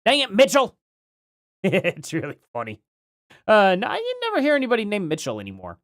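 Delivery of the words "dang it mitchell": louder, higher mock yell. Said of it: "That redneck guy".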